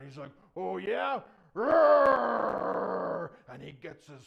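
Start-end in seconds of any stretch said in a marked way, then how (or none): 0.6-1.2 very loud, high-pitched angry challenge; mad giant. Acted. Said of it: "he gets mad". 1.5-3.4 long, very loud roar; giant's angry roar. Acted. Enraged giant roars.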